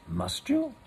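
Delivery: prim polite question, pitched high. A narrator's reading. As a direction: ask it high and politely, hoping he goes. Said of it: "said Rabbit politely".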